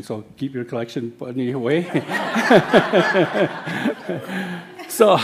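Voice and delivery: a loud, high burst of laughter at his own joke; real laugh. Real, not acted. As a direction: laugh hard at the punchline.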